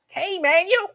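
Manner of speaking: high, lisping boxer voice; Mike Tyson impression. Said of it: "God come in sounding like Mike Tyson".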